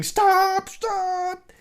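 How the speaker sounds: very high, drawn-out mock scream; imitated scream. Force Ghost shouting at the biker, mock screaming. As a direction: squeal it out far above your voice, not full volume. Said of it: "s-, screaming".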